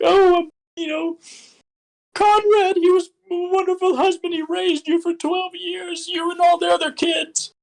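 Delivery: very high, put-on old aunt voice, slowing down; grieving aunt voice. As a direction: play the aunt telling a nephew about his dead uncle. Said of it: "be the aunt of the uncle".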